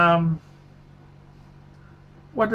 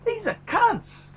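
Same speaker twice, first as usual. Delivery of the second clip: contemptuous outburst; 11 semitones higher, slower than usual. Real, not acted. Ranting about dealers again.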